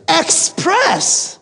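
high, drawn-out gotcha; smug pun. Retold or imitated. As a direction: land the pun with relish. Punning jab during argument.

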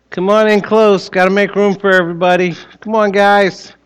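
put-on high, gentle voice for little kids; sweet patient sunday school. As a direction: coax the children in kindly. Children's talk before Children's Church.

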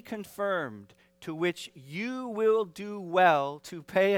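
measured, drawn-out scripture reading, phrase by phrase; slow group reading. Shown as a demonstration. Preacher leads the congregation reading scripture together, slow and deliberate. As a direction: read aloud slowly and deliberately. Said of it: "I'll read it slow enough that we can all read it together".

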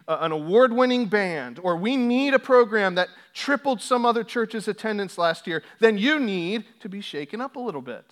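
loud, rising sermon build; preaching at full force. Acted. Preacher shaking up his congregation, full-force preaching.

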